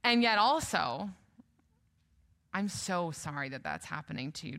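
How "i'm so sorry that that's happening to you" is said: gentle comfort. Retold or imitated. Comforting a grieving friend.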